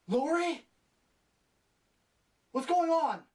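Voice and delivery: high, loud, hammed-up worried questions; mock startled calling. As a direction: call out like a clueless spooked character. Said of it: "same reaction when they heard a noise".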